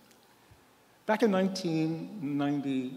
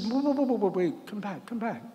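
higher, urgent call after someone leaving; calling back; 5 semitones higher than usual. Retold or imitated. Priest calling the departing nurse back, high and urgent. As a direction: call her back before she's out the door.

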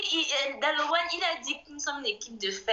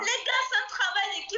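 pleading shout; 7 semitones higher than usual. Real, not acted. Pleading with viewers, live match.

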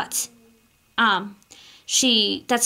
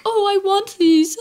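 high, louder mock whine of an impatient kid; whiny wanting; 10 semitones higher, 5 dB louder than usual. Kitty Nay impatient for her horses, mock whine. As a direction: play the impatient girl grabbing for her package. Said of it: "So she was like".

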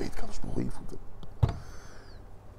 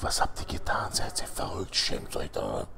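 hissed whispered rebuke; scolding whisper; 14 semitones lower, 11 dB quieter than usual. Acted. Capo woman to the girls at night, scolding whisper. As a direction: whisper the reproach so no one hears.

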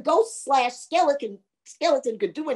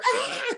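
ghost's scream; 13 semitones higher than usual. Acted. Ghost's skull whacked across the road, high mock scream. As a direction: let out a high storybook scream. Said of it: "screamed the ghost".

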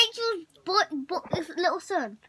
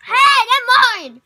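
shout; 7 semitones higher, 13 dB louder, much slower than usual. Real, not acted. Snack grabbed by friend.

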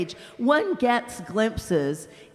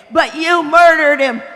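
big ghost, indignant; 6 semitones higher, 11 dB louder than usual. Acted. Big ghost shouting at the bright spirit, loud and outraged. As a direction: bellow it like an aggrieved bully.

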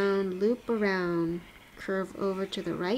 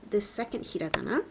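normal talking voice

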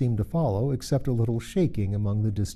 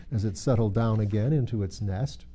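ordinary delivery, nothing marked

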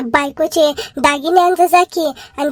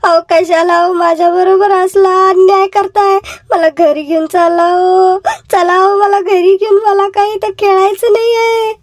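wife crying; 3 semitones higher than usual. Acted. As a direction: plead through tears with your husband. Wife begs to go home.

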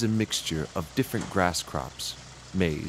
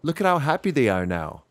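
higher, lightly voiced Eloise; Eloise's voice; 7 semitones higher than usual. Eloise pointing out happy cows, lightly higher voice. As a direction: point it out brightly. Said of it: "points out Eloise".

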